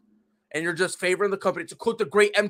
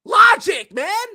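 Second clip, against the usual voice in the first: loud shout; 11 semitones higher, 10 dB louder, much slower than usual. Real, not acted. Ranting at fans, a loud high shout. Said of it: "exclaiming in disbelief".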